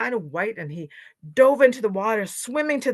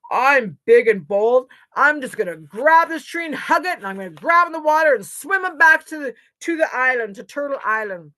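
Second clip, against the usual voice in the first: high, loud boastful bear; bold boaster; 6 semitones higher, 9 dB louder than usual. A narrator's reading. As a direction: declare it with big bravado. Bear boasts of his plan.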